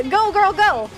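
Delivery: ordinary talk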